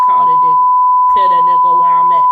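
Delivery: high, loud furious shout; dad's yell. Retold or imitated. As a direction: bellow it like an enraged father. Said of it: "came to my house and was like".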